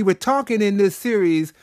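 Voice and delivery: normal talking voice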